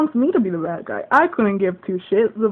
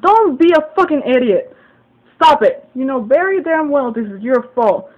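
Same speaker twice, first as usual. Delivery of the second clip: loud, higher-pitched angry telling-off, retold; pissed-off cursing; 4 semitones higher, 9 dB louder than usual. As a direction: reenact chewing him out, hard and loud. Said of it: "I was cursing at him. I was so pissed".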